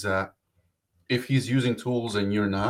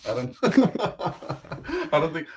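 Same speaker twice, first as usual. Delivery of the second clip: laughing; 6 semitones higher, much slower than usual. Real, not acted. Laughing at the California joke.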